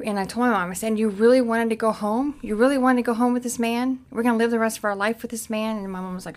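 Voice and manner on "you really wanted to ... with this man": raised, loud accusing questions; retold protest, louder and higher as it builds; incredulous confrontation. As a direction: challenge her in disbelief. Retelling confronting mom after the fight.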